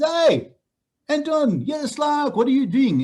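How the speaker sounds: put-on high, loud friendly hello to an old mate; cheery school friend. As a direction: greet him like a school friend you just bumped into. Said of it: "he says".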